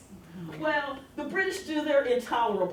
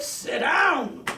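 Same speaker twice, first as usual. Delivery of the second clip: louder, higher stern order; preacher's command; 6 semitones higher, 7 dB louder than usual. Retold or imitated. Tobias ordering Garrick again, sharp loud command. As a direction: bark it so he stays seated.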